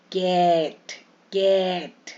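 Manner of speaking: कैट with a wide open ऐ; wide ae sound. Shown as a demonstration. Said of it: "फिर बड़ा ए". (कैट में खुला ऐ)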